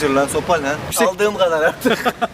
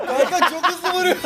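laughing; 6 semitones higher than usual. Real, not acted. Laughing while being hit.

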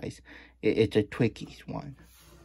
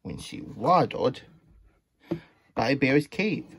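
ordinary delivery

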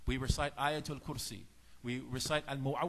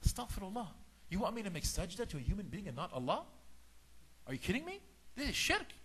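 very high, incredulous squeal; feigned pious shock; 6 semitones higher, 4 dB quieter than usual. Retold or imitated. Shaitan defending his refusal to bow, mock horror. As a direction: play scandalized innocence. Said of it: "And Shaitan says".

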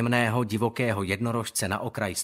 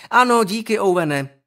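sharp, louder teacher interruption; cutting him off; 7 semitones higher, 8 dB louder than usual. Acted. Teacher cuts off Owen, sharp and loud. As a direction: shut him down firmly. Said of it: "utnula ho učitelka".

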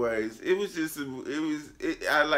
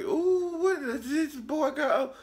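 mocking high voice; 3 dB louder than usual. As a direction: shriek it out in shocked disbelief. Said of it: "And I was just like".

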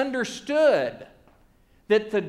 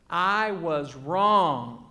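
very slow, lower pitch; solemn confession; 4 semitones lower, much slower than usual. Retold or imitated. Preacher quoting Paul's admission, slow and weighty. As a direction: let each word stretch out.

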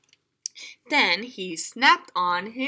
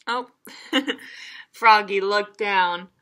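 ordinary delivery, nothing marked

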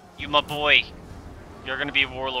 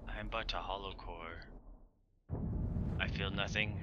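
much softer, lower, hollow mock lament; mock despair; 5 semitones lower, 17 dB quieter than usual. Gamer mourning his lost battle in hushed mock despair. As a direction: play the broken man, hushed and low. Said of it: "I am a broken, shallow man".

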